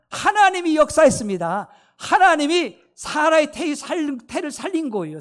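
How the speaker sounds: forceful preacher's proclamation; proclaiming loudly. Real, not acted. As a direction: declare God's work to the congregation.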